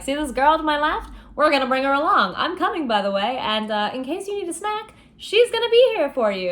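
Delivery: cocky high mock voice; hammed-up Jax. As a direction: sell it like a smug sidekick pitching a plan. Jax teasing Chaos, hammed up.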